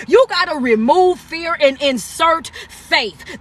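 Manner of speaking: slow, deliberate motivational mantra; slow repeat-after-me line. Shown as a demonstration. Coach giving his live viewers a mantra, slow and deliberate. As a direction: lay it out slowly so viewers can repeat it. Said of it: "I want you to say this".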